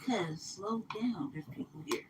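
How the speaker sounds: slow, unvoiced whisper of a warning. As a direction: hiss it under your breath so nobody hears. Hissing at greedy eater.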